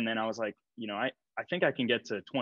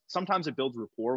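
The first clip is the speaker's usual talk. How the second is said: ordinary delivery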